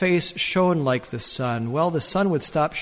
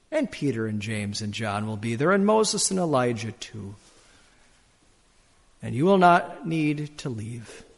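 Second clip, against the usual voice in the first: voice of Jesus; 4 semitones higher than usual. Acted. Voicing Jesus speaking to sinner.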